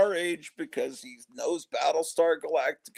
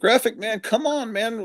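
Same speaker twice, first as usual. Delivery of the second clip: excited call; 8 semitones higher, 4 dB louder than usual. Real, not acted. Calling out to the guest.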